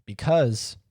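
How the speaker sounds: ordinary talk, nothing marked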